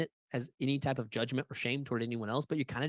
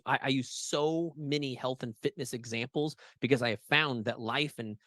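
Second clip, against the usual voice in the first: usual speaking voice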